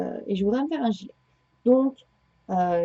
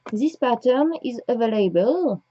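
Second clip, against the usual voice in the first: English read slowly in a thick French accent; strong French accent. Shown as a demonstration. Reading the pattern page aloud to viewers, thick French accent. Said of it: "avec mon accent, euh, de ouf".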